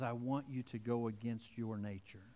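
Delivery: hushed divine voice calling you to wait; soft voice of God. Acted. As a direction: speak as God, quietly and tenderly. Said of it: "God says".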